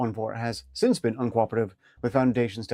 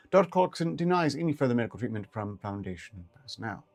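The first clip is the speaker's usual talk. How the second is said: plain reading voice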